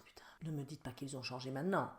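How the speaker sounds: soft unvoiced panicked whisper; mother checking the rules, panicking; stressed whisper. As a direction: whisper it in dread. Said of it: "En me stressant, en me disant".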